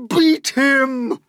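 high, loud spiteful cry; Aunt Sponge crying out. Acted. Aunt Sponge urging her sister, high and loud. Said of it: "cried Aunt Sponge".

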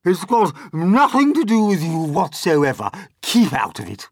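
loud, forceful telling-off; scolding declaration. A narrator's reading. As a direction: declare it to shut the boy out. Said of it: "Aunt Sponge declared".

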